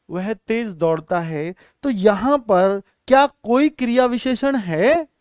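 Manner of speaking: ordinary talk, nothing marked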